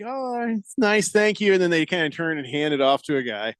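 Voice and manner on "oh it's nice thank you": mock polite thanks. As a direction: imitate politely feigned appreciation. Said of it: "they're like".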